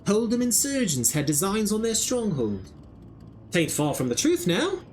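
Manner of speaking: put-on a slipping country accent, a hint only; half-held rustic English accent; wavering rustic accent. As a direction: drop your g's like a rough scout, accent coming and going. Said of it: "I kept switching accents".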